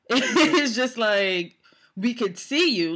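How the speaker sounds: loud, drawn-out laughing words; laughing in disbelief. Real, not acted. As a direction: laugh your way through it in disbelief. Laughs in disbelief while venting.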